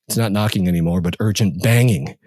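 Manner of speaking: ordinary delivery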